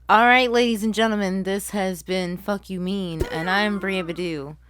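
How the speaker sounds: loud host sign-off; show sign-off. Acted. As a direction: announce the show's close. Closing show announcement.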